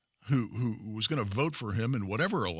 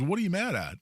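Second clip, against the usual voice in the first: higher-pitched retold challenge from an angry dad; dad's sharp challenge; 7 semitones higher than usual. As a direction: imitate a dad confronting his son. Retold dad challenging his son.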